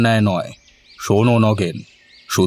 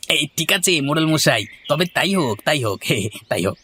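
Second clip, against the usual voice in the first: high gloating agreement, trailing off softer; gleeful higher toady fading; 6 semitones higher, 3 dB quieter, faster than usual. Acted. Nagen gloats about the cooking contest, higher then fading. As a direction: gloat higher then let it fade away.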